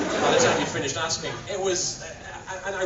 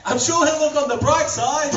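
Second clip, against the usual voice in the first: high loud character quip; 12 semitones higher, 9 dB louder than usual. Acted. Joke delivered in character.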